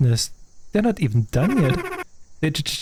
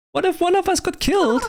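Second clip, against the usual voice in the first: high indignant; 13 semitones higher than usual. Acted. Worker indignant at boss.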